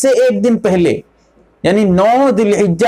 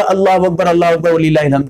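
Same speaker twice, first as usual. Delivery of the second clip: quick throwaway अल्लाहु Akbar, वलिल्लाहिल हम्द; rushed wrong version; 2 semitones lower, faster than usual. Shown as a demonstration. Teacher mimicking the common mistake, rushed. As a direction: rattle it off fast as people wrongly do. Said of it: "यहां पर लोग दोनों जगह".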